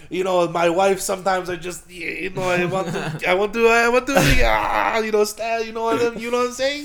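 mock Italian host climbing high and worked up; Italian accent. TV host hinting to his guest, agitated mock Italian. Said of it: "like, an Italian".

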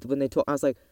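ordinary delivery

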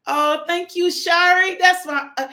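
high, excited gushing thanks; delighted. Real, not acted. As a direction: gush loudly at a dear friend, pitch up.

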